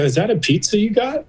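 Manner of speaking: high, feigned-oblivious question; deflecting question. Retold or imitated. Patient ignores his friend's question to avoid telling him, deflecting. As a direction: dodge the subject with a high-pitched question. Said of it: "'Cause I'm ignoring him".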